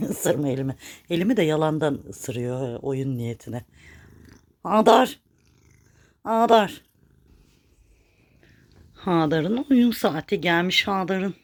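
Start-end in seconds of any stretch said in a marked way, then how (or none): 4.6-6.8 loud, high call of the name; calling the cat. Real, not acted. Owner calling her cat Hadar, loud and high. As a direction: call the cat from across the room.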